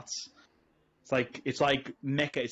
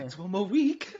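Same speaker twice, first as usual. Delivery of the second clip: higher, laughing countdown tease; laughing mock announcement, higher; mock host hype, laughing; 5 semitones higher, slower than usual. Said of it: "he'll be like".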